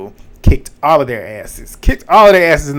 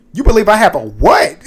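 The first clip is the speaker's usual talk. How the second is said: loud, high, incredulous comeback; sassy disbelief; 6 semitones higher, 4 dB louder than usual. Retold or imitated. Ava firing back at Keanu, loud incredulous sass. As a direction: snap back like you can't believe the nerve. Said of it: "what I just did is what Ava did".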